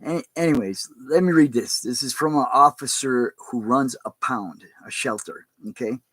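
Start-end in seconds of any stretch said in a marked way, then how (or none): none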